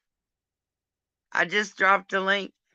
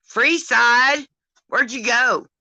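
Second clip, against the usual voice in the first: calling out; 6 semitones higher, 5 dB louder, slower than usual. Real, not acted. Calling out to viewer.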